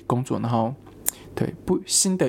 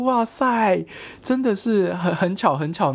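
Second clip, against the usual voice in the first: amazed; 7 semitones higher, slower than usual. Retold or imitated. Marvels at coincidence.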